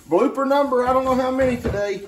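high laughing giggle into the words; laughing at himself. Real, not acted. Cook laughing at his kitchen blooper, high and laughing. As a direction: laugh out the blooper count, pitch up.

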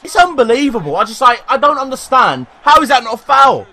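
high, loud, incredulous complaint; outraged protest. Real, not acted. Gamer who cannot understand the missed foul, outraged shouting. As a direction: rail at the referee in disbelief.

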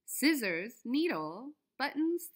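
ordinary delivery

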